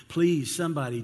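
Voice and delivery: loud pleading outcry; the tormented rich man calls out loudly for relief; anguished cry. Acted. Rich man cries out in agony.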